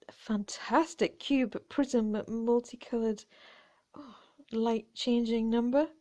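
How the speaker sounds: ordinary talk, nothing marked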